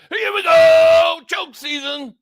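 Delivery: loud, high, drawn-out hollered catchphrase; hammed-up mock shout; Dak voice. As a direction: holler it like a quarterback's cadence, stretching GO. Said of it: "Dak voice".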